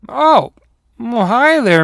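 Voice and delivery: high, loud "oh" of surprise; loud, high, startled hello; surprised uncle greeting. Acted. Uncle greets odd creature, surprised.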